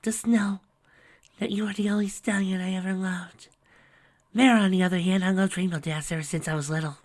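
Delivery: tender last confession; Fluttershy's confession. Acted.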